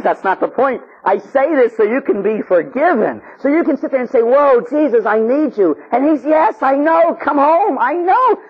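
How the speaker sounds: high, urgent preacher's cry; impassioned preaching. Acted. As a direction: preach it insistently at full pitch. Preaches at full force.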